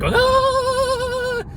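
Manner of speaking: a high sung-out yelp of panic; mock panicked yell. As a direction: reenact the bathroom noise with a high wail. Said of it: "I'm literally doing the same noises".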